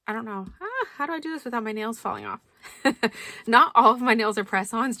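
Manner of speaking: ordinary talk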